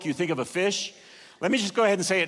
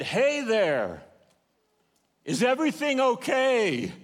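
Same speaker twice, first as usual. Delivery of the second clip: calling from shore; 6 semitones higher than usual. Retold or imitated. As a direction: hail them from a pier a hundred yards off. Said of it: "that man said".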